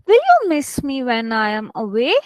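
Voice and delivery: "away" swung up at the end; teacher's model question with rising "away"; question intonation. Shown as a demonstration. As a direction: ask it, lifting clearly on "away". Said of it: "it should sound like a question".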